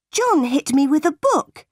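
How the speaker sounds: high-pitched accusation; indignant protest. A narrator's reading. Jane telling her aunt about John, indignantly.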